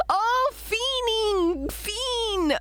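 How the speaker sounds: high, slow amused realization; drawing out the word with a laugh. Real, not acted. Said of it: "I spell it like [name] song".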